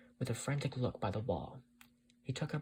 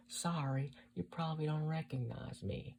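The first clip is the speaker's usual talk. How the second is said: put-on slightly raised Southern-accented voice; heavily accented woman; 5 semitones higher than usual. A narrator's reading. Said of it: "a heavily accented female voice".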